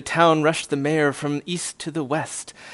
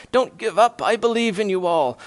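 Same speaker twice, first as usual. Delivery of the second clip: calling out; 6 semitones higher, 4 dB louder than usual. Acted. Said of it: "he managed to call to the mayor".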